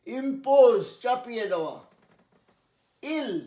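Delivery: normal talking voice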